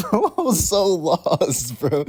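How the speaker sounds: high, loud, drawn-out laughing wail; helpless laughter breaking into the words; laughing hard. Real, not acted. Laughs at own past confusion.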